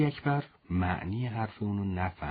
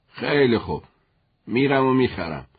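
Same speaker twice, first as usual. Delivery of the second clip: louder, firm and insistent; firm condition; 6 dB louder than usual. Acted. Father agrees to buy shoes but firmly demands his son wear them. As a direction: lay down the terms firmly.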